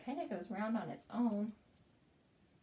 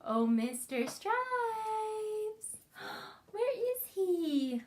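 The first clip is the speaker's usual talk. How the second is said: calling out; 11 semitones higher, 5 dB louder, slower than usual. Shown as a demonstration.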